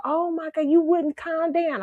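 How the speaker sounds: high-pitched, drawn-out recollection; exclaiming grandfather. Retold or imitated. Grandfather remembering the child's fuss, exclaiming. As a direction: voice the grandfather high, stretching the last words. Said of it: "He's like".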